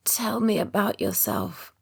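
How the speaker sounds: slow hoarse croak. A narrator's reading. Exhausted patient croaking a request to Ben. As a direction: rasp it out weakly. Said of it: "I croak".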